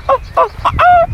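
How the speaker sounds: high squawky birdsong mimicry; bird call imitation.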